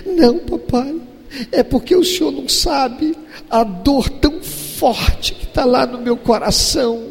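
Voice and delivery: father mimics his daughter's tearful plea; imitating tearful daughter. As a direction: plead like a hurt little girl. Father voices crying daughter.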